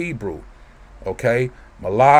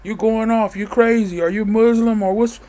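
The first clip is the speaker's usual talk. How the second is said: much higher mock-puzzled questioning voice; mocking commenters; 9 semitones higher than usual. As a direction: parody the confused commenters, pitched way up. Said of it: "Sometimes people leave comments".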